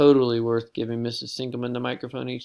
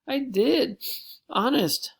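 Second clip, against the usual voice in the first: much higher, pleading; desperate plea; 13 semitones higher, slower than usual. Acted. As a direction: beg to be believed. Pleading with teacher.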